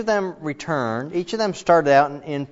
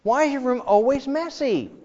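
nagging mom; 12 semitones higher than usual. Retold or imitated. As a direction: nag like a fed-up mom.

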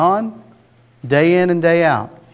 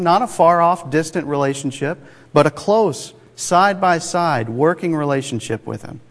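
normal talking voice

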